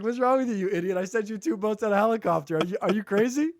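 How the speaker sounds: high-pitched, incredulous telling-off; acted disbelief, voice pushed well up; exasperated scolding. God scolds the man who refused rescue, incredulous. God's punchline acted again.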